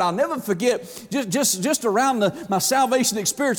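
ordinary talk

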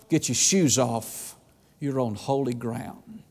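low, slow and soft, each word weighed; slow it down to a reverent hush; slow hushed command. Acted. The Lord tells Joshua to remove his shoes, slow and hushed.

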